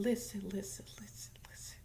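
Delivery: hushed repeated listen; whisper. Acted. Whispering a secret to the viewers, hushed. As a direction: lean in and whisper it.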